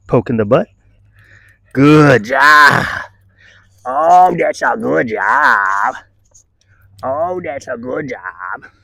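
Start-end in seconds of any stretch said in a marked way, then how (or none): 1.7-2.8 loud praise. Real, not acted. 3.8-8.7 slow affectionate dog praise; tender praise. Real, not acted.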